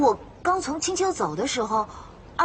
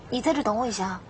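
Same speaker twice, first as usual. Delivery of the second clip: ordinary talk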